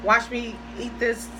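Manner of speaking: usual speaking voice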